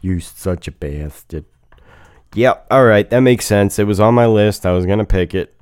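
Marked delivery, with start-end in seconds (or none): 0.0-1.4 low playful grumble; mock grumble. Real, not acted. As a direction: grumble it, teasing. Rival takes wanted pick.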